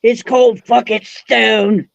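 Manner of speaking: put-on slow, hammy Australian accent; fake Aussie accent. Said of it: "my fake Aussie accent".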